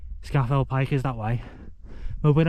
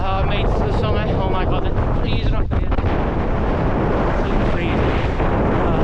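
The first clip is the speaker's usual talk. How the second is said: excited, cold; 5 semitones higher than usual. Real, not acted. Cold summit exclamations.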